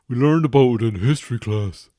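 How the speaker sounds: put-on grumbling teen brushing off his father; sulky teenage son. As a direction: play the moody teen who's fed up with his dad. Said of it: "Why are you talking like that?".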